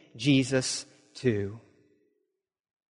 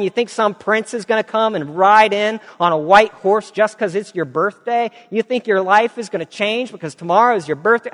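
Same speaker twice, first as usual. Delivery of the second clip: high, sneering taunts; mocking ridicule; 6 semitones higher, 4 dB louder than usual. Other women mocking Mabel's birthday hopes, sneering. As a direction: taunt her like a jeering crowd. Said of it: "started to ridicule her".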